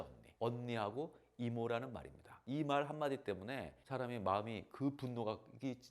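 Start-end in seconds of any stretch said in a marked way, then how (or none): none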